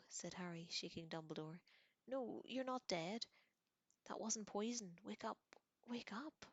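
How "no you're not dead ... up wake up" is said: desperate pleading. A narrator's reading. Harry desperately trying to wake Dumbledore.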